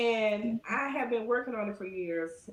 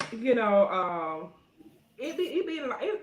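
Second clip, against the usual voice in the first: ordinary delivery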